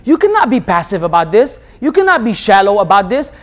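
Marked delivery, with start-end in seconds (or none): none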